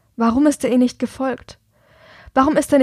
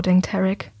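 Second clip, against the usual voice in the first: ordinary delivery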